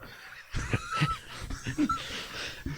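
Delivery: high, squealing laugh; real high laughing fit; helpless laughter. Real, not acted. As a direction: crack up completely. Game master cracks up.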